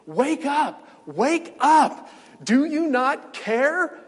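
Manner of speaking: high, loud frantic shouting; panicked scream. Retold or imitated. Disciples screaming at sleeping Jesus in the storm, loud and frantic. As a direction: yell to wake a sleeper in a storm. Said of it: "they began to scream".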